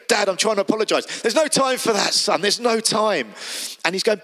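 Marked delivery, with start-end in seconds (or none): 0.1-1.0 higher, breathless plea to be heard; squeezed son trying to get words out; pleading son. Acted. Son muffled in father's hug. 1.2-3.3 high, joyful brushing-off of the apology; a delighted dad who won't let him finish; overjoyed father. Acted. Overjoyed father hugging returning son.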